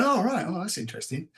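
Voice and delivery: a hint of wide-eyed children; children impressed. Children learning about articulated lorries, mild mock wonder. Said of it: "And they go".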